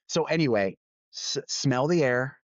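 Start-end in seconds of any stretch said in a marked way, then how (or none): 1.1-2.4 slow emphasis. Real, not acted. Repeats the lesson as advice.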